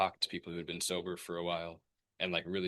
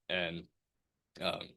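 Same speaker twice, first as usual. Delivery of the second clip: ordinary talk, nothing marked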